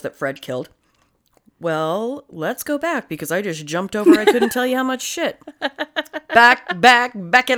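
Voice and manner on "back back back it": playful chanted backing-up call; truck-guide call. Pretend. Guiding a dump truck backwards, playful call-out.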